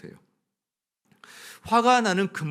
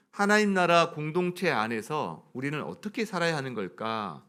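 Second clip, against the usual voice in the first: usual speaking voice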